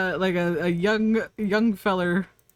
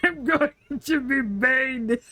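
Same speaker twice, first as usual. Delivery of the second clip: put-on slow, heavy Austrian accent, lightly done; a big action star announcing himself, slowly; lightly voiced Austrian bodybuilder; Arnie impression; 3 semitones higher than usual. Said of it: "Oh, my Arnie impression?".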